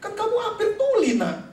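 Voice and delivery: very high, startled exclamation; amazed, high-pitched protest; astonished parent. Retold or imitated. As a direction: blurt it out in disbelief to your child. Father astonished at child's hearing.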